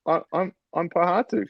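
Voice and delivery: high-pitched bewildered stammer; retold nervous, repeated false starts; confused. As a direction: imitate a baffled trainee struggling to answer. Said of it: "doesn't know what's going on".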